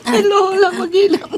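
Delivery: being tickled. Real, not acted. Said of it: "Nakikiliti ako sa silong eh".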